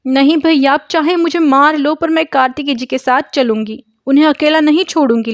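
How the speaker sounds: high, loud desperate insistence; stubborn pleading. Acted. Lakshmi defies Murthy to stay with her husband, high and loud. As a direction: refuse to be left behind, whatever it takes. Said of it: "Lakshmi जिद कर रही थी".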